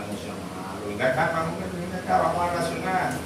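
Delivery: low, hushed wheedling voice; soft coaxing. Retold or imitated. Preacher imitating tempting spirits, hushed and wheedling. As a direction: coax him in gently like a tempter.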